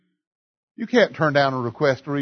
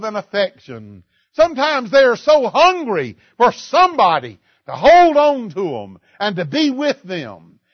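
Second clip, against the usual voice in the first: rising, louder sermon voice with slow stressed lines; fervent preaching; 6 semitones higher, 4 dB louder than usual. Acted. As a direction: preach it out to the congregation. Preacher builds to full force.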